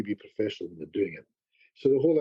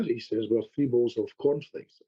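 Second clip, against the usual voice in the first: ordinary delivery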